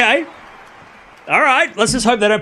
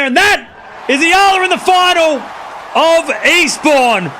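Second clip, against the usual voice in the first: much higher, louder, slow and stretched; triumphant call; 8 semitones higher, 3 dB louder, slower than usual. Real, not acted. Streamer announcing her player is in the final, slow triumphant call. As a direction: ring it out like a victory call. Calling the win live.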